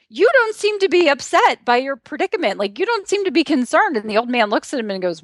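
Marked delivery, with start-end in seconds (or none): 0.1-4.0 raised, higher voice of a puzzled attorney; lightly acted lawyer voice; lawyer quote. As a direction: ask it like a lawyer amazed at his client's calm. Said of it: "And he says".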